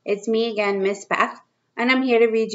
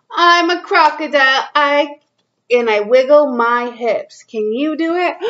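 put-on reading to children; 3 semitones higher, 3 dB louder than usual. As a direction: read it out to little kids, drawing each line out. Reads picture book to kids.

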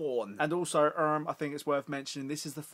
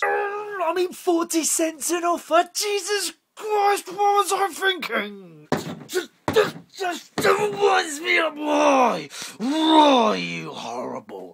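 angry rant; 13 semitones higher, 11 dB louder, slower than usual. Acted. Ranting at the offers.

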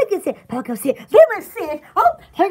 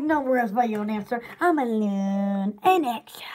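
mock reassurance; 10 semitones lower, 6 dB quieter than usual. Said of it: "I'm like".

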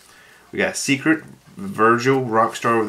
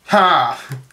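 short gloating laugh; triumphant laugh; 4 semitones higher, 6 dB louder than usual. Real, not acted. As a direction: bark out a victorious ha. Laughs at opponent's common.

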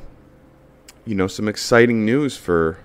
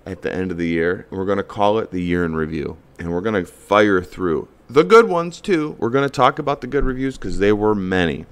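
usual speaking voice

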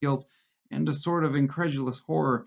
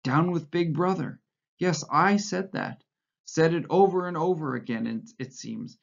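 ordinary delivery, nothing marked

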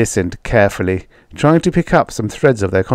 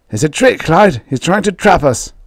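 frightened whisper-urgent farmer; 9 semitones higher, 3 dB louder than usual. Acted. Meek farmer fears trap.

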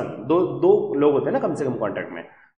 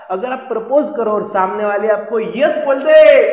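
louder, higher excited build; 6 semitones higher, 10 dB louder than usual. Acted. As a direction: build excitement toward the yes. Excited telling of yes. (उत्साह से ऊँची और ज़ोरदार आवाज़ में)

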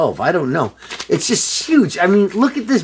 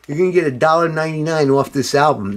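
usual speaking voice

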